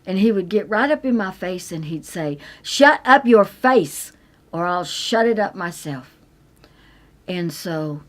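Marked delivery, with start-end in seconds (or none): 2.6-6.2 husband's angry threat. Retold or imitated. Husband shouting at his crying wife, furious threat. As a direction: snarl it right up in her face. Abusive husband yelling in her face.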